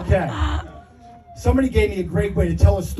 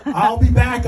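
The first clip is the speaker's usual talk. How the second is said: higher, louder mock call over the shoulder; half-hearted playful yell, pitched up; 5 semitones higher, 4 dB louder than usual. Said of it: "I yelled".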